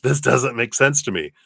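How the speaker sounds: laughing through baffled disbelief. Retold or imitated. Recalling unexpected promotions to listeners, laughing baffled disbelief. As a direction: laugh at how absurd it seemed. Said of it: "and I was like".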